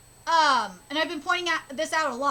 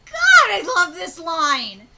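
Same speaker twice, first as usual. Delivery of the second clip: loud, slow, gushing; excited outburst; 11 dB louder, slower than usual. Real, not acted. Gamer loudly loving a line of lore. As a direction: belt out your delight.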